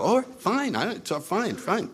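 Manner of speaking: high, lighter retold "fine"; flustered giving up. Questioner giving up on a stubborn newcomer, flustered. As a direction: brush it off in a high, softening voice.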